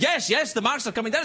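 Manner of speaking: put-on high, insistent kid's retort; arguing child. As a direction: argue back like a stubborn child. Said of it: "We had our first argument".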